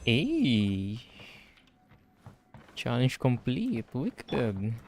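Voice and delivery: ordinary delivery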